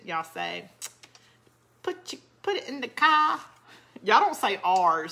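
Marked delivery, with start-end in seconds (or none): 1.8-3.4 higher-pitched mock Boston accent, r's dropped; attempted Boston accent. Southern crafter imitating how Boston viewers talk, mock Boston accent. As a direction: try on a Boston accent, badly. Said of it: "try to have an accent like Boston".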